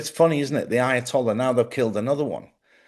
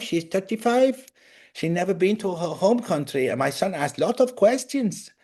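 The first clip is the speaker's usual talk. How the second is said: put-on higher-pitched, lilting Iranian accent; 4 semitones higher than usual. Host quoting his Iranian dentist friend, Iranian accent. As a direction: imitate an Iranian friend, accent lifting high. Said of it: "they all have the same sort of accent".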